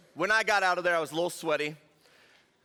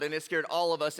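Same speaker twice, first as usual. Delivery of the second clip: ordinary talk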